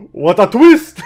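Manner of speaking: high, loud yell of delight; real excited exclamation; amazed outburst. Real, not acted. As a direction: shout it, cracking up at the surprise. Reacting to a plot twist.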